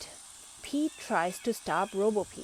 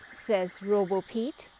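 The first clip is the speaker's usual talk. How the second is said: ordinary delivery, nothing marked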